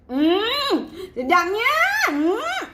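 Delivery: high savouring hums round a delighted exclamation; expressive tasting delight. Shown as a demonstration. Coach models her tasting expression, delighted. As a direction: swoon over the food in rising hums. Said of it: "macam mana expression [name]".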